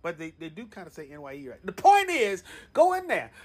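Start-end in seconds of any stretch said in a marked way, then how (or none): 0.0-1.7 much softer, quick unsure mutter; quiet aside. Real, not acted. Host second-guessing the acronym to himself, hushed and quick. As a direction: drop the voice to check yourself. Doubting aside after the shout.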